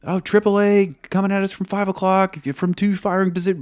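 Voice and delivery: high, loud urgent scream of positions; co-pilot screaming gunfire positions; screaming callout. Retold or imitated. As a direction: yell the threat call in panic. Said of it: "So he starts screaming".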